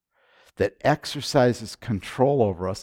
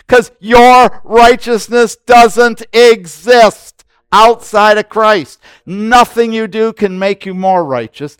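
loud, high, driving pulpit voice; rising sermon fervour, hammering each point; full-force preaching; 7 semitones higher, 11 dB louder than usual. Acted. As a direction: preach it hard to the congregation.

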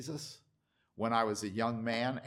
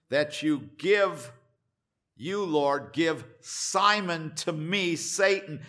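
slow, louder, insistent demand; strong demanding petition; 4 semitones higher, 5 dB louder, slower than usual. Acted. Satan demanding Simon in heaven's courtroom, slow forceful petition. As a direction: press the claim forcefully, phrase by phrase. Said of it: "he made a strong petition".

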